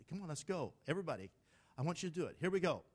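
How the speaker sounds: ordinary delivery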